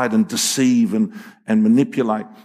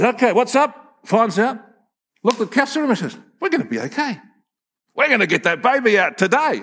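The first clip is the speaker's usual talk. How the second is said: a hint of a brisk, breezy doctor, higher; lightly voiced confident doctor; 8 semitones higher than usual. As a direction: breeze in like the unflappable specialist.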